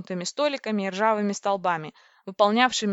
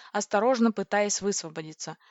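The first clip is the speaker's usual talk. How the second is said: ordinary delivery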